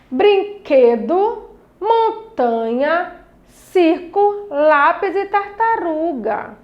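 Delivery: slowly, syllable by syllable; slow syllable-stressed reading. Shown as a demonstration. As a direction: draw out each word so the stressed syllable stands out. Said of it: "que eu falava com maior intensidade".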